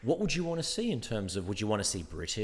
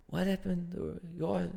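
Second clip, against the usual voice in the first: higher, hesitant puzzled voice; confused co-star; 8 semitones higher, 3 dB quieter, slower than usual. Retold or imitated. Co-star hears the real accent, quietly confused. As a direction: lightly voice a thrown co-star. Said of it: "stayed in his character".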